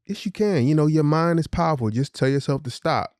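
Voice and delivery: encouraging dad. Retold or imitated. Father coaxes daughter to stop.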